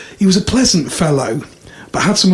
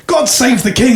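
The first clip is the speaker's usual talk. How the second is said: higher-pitched mock patriotic cry; mock shout; 6 semitones higher than usual. Brit in a joke before shooting himself, mock shout. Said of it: "he shouts".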